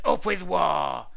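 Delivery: loud, slow war chant; storybook shout, drawn out. A narrator's reading. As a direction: shout it as a mean dinosaur's slogan. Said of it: "they shouted".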